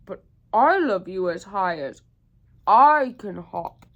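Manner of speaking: proud big hare bellow; big hare outdoing him. Acted. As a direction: boom it out to top the little one. Big hare answers back proudly.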